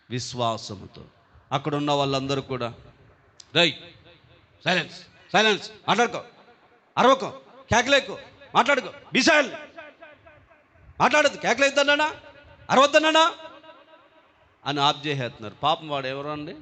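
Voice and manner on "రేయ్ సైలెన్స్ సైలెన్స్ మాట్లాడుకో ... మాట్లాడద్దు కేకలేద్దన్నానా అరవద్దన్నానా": rising, louder telling off; scolding hush. Acted. Bystanders silencing the shouting blind beggar, scolding. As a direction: shush a troublemaker sharply and impatiently. Crowd hushing the blind man.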